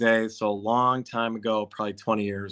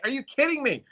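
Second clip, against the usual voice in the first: disbelief. Retold or imitated. Stunned by agent giving up.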